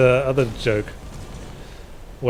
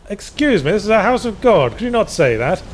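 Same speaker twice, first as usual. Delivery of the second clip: higher-pitched, mock-offended priest; indignant priest; 7 semitones higher than usual. Priest objecting to chef's swearing in a joke, mock indignant. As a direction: scold primly, pitched well above your usual voice.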